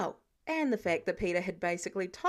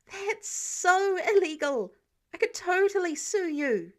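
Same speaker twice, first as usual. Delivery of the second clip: shocked teen voicing outrage, much higher and louder; outraged protest; 8 semitones higher, 6 dB louder than usual. Peter outraged at Tony's stalking idea.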